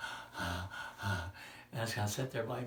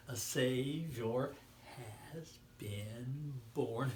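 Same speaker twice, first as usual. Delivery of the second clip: very slow, deliberate words; slow proclamation; 3 dB louder, much slower than usual. Acted. Preacher proclaiming the birth very slowly. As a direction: stretch each word out solemnly.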